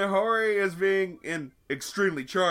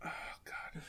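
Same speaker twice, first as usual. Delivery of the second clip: whispering; 17 dB quieter than usual. Real, not acted. Dismay at the forum picture.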